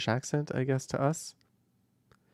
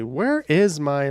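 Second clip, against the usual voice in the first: ordinary talk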